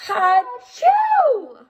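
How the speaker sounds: hammed-up gasp and sneeze; big sneeze. Storyteller acts out the wolf's sneeze at the stick house.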